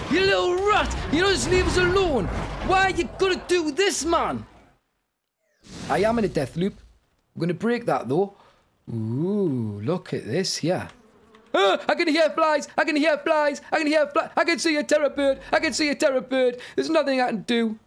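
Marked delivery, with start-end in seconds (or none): 0.1-4.5 high-pitched indignant yelling; exasperated outburst. Real, not acted. Player to creature that won't leave them alone, exasperated yell. Berating an attacker in game. 11.5-17.8 high, frantic repeated alarm; panicked cries, pitched well up; rising panic. Real, not acted. Player hearing flies and seeing terror bird, panicked cry. Predators closing in.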